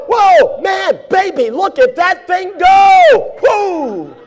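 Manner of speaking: high, loud stadium whoop; sports fan hollering at the ball game; excited fan shout. Retold or imitated. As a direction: cheer like a fan in the stands. Acted fan cheering at ball game.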